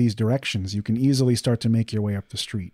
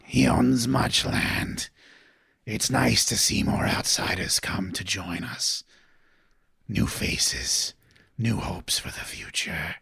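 creepy whisper; 4 dB quieter, slower than usual. Acted. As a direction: whisper like a crucified corpse. Skeleton signpost speaks.

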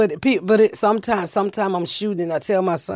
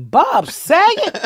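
high, slow and louder, drawn out in disbelief; shocked recognition; 12 semitones higher, 5 dB louder, much slower than usual. Retold or imitated. Family opening the door to a famous guest, shocked and slow. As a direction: gasp the famous name, stretched out. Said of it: "they was like".

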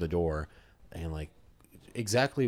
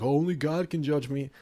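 higher, louder mock-defiant quote; mock declaration; 9 semitones higher, 5 dB louder than usual. Imitating everyone defying the judge, mock declaration. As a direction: declare it like everyone's favorite motto. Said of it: "Everyone's like".

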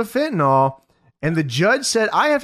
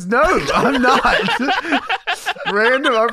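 laughing; 7 semitones higher than usual. Real, not acted. Cracking up mid-reading.

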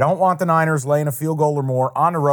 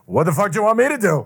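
mock exasperated kicker; 5 semitones higher, faster than usual. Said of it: "looks right at the camera and goes".

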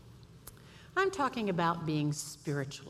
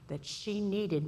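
ordinary talk, nothing marked

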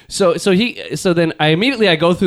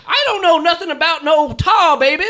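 mock Southern voice; 9 semitones higher than usual. Joking about Song of the South, high mock Southern twang. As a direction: ham up a cartoon Southern denial.

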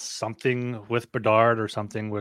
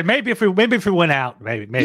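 higher, louder whiny mimic of his hope; mocking imitation; 6 semitones higher, 7 dB louder than usual. Co-host mimicking hopeful fan, mocking whine. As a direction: parody a desperate fan. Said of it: "You say".

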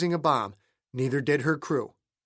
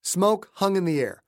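plain reading voice

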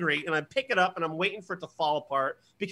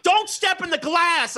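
high, loud, slow parental yell; acted frantic warning shout; yelling at kids; 11 semitones higher, 5 dB louder, slower than usual. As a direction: yell it across the room at the kids. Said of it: "I'm yelling at my kids".

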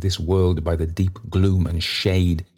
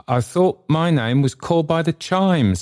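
high-pitched, pleading character voice; timid appeal, pitched high; supplicating; 10 semitones higher than usual. A narrator's reading. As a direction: plead upward in a much higher voice. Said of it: "in an attitude of supplication".

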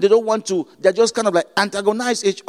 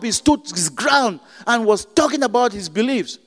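higher, louder, urgent preaching; fervent preaching; 5 semitones higher, 3 dB louder than usual. Acted. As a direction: challenge the congregation. Rising sermon exhortation.

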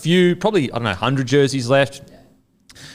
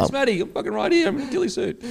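high, softer mock plea from the hiding spot; mock Matty; 11 semitones higher, 4 dB quieter, faster than usual. Mate mocking Matty unseen in his ghillie suit, high soft plea. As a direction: squeak it out like the unseen guy in the corner.

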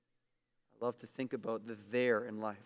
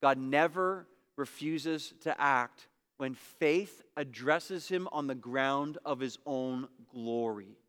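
slow, deliberate reading of never; measured slow quotation; slow quote reading; slower than usual. Shown as a demonstration. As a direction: read the quote slowly, weighing each word. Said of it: "A W Pink said this".

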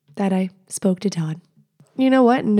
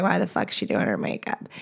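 mocking commenter; 13 semitones lower, 7 dB quieter than usual. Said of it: "Someone's like".

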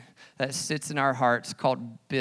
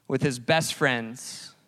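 usual speaking voice

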